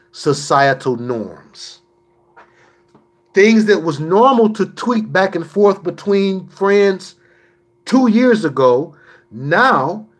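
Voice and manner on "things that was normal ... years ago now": preaching. Acted. Preaching at full force.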